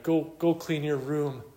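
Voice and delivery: high, hammed-up nagging mother; overdone motherly order. Mother ordering her son to clean up, overdone.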